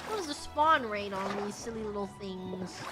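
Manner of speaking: ordinary delivery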